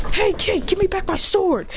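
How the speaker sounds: demanding hero. Acted. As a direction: call out for the sword, lightly hammed-up. Character demands his sword back.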